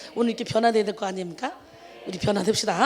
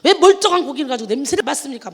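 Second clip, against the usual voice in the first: louder retold bark from the fish seller; a hint of a shopkeeper snapping; mock angry yell; 7 dB louder than usual. Fish seller yelling at a sniffing customer, mock angry. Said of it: "소리를 버럭 지르면서".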